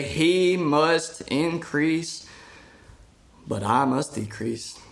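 "He must increase" drawn out very slowly, then dropping; slow scripture quote. Shown as a demonstration. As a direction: savour each word of the verse. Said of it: "He went on to say".

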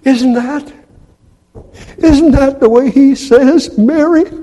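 high, rising amazement; Mary's dawning joy. Acted. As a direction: let the joy dawn on her. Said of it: "she instantly thought".

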